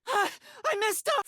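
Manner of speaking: high, startled cry of dismay; high yelp over a mistake. Acted. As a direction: yelp at your own mistake. Blunder mid-disarm.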